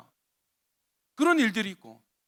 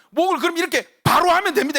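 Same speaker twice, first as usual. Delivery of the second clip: firm command; 7 semitones higher, 9 dB louder than usual. Retold or imitated. Pastor instructs the stiff sister.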